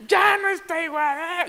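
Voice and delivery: high, loud mock-tantrum wail; berrinche de niño imitado. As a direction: scream it like an outraged toddler. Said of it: "Fue como". (berrinche de niño chiquito, a gritos agudos)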